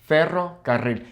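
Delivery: "Ferrocarril" with both double R's trilled; rolled R's. Shown as a demonstration. Repeating for learners, trilled "Ferrocarril". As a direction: trill twice through "Ferrocarril". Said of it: "learn how to roll your R's".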